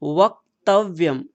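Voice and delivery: वक्तव्यम said slowly, half क and half व joined clearly; careful pronunciation. Shown as a demonstration. Teacher models वक्तव्यम for children, slow and careful. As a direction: spell out वक्तव्यम syllable by syllable, slow. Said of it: "आप उच्चारण करके देखिए इसको".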